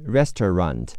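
"restaurant" the American way, "RES-tuh-rahnt"; American pronunciation. Shown as a demonstration. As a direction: say "restaurant" in American English, three syllables. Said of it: "美式发音是".

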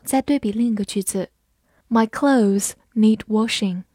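ordinary talk, nothing marked

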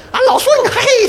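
laughing mock Sun Wukong whoop. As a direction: burst out like the Monkey King freed from the mountain. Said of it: "就跟孙悟空从那个五行山出来以后".

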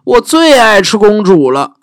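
high, hammed-up dragon boasting; a greedy dragon showing off.

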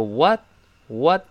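WHAT said slowly with an open American vowel; American pronunciation. Shown as a demonstration. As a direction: say "what" American style, mouth a bit wider. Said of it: "注意美式发音，口型还是略大一些". (美式发音，what口型略大)

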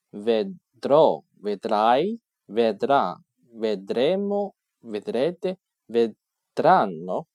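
vedrò，vedrai，vedrà said slowly, each form clearly separated; slow conjugation drill. Shown as a demonstration. Teacher reciting forms slowly to students, deliberate. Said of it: "六个不同的变化是什么呢".

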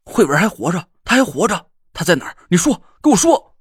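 desperate, frantic yell; frantic shouting. A narrator's reading. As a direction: shake the answer out of him, shouting. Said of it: "发疯一样地摇晃着".

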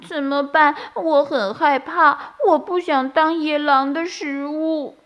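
high, small scared bunny voice; frightened. A narrator's reading. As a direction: whimper it out, afraid of being eaten. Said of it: "晶晶很害怕地说". (晶晶害怕地说)

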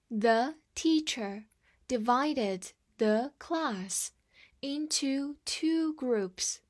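slow, word-by-word model reading. Shown as a demonstration. Teacher slowly models the sentence for students, slow and clear. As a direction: read it slowly for the class to follow. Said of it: "我们再读一遍".

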